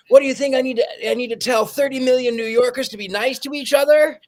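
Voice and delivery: playing the exasperated mayor. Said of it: "he says".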